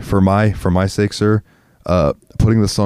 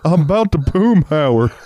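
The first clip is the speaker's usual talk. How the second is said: much higher, strained Texan dad voice; Hank Hill voice; 10 semitones higher than usual. Retold or imitated. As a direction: squeeze it out like a flustered Texan dad. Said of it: "Mike Judge is railing some chick".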